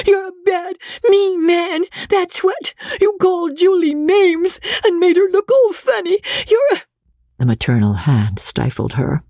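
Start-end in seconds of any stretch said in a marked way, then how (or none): 0.1-6.8 put-on very high little-girl voice, near crying; small child's furious tearful tirade; tearful child's outburst. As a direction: hurl accusations while holding back tears. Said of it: "effort to control her tears".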